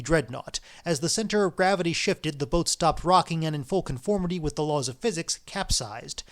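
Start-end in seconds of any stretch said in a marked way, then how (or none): none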